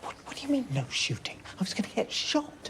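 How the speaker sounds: hushed, frantic disbelief; frantic whisper at a colleague about a robbery; panicked whisper. Real, not acted. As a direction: whisper it, scared stiff. Frightened whisper in the bank.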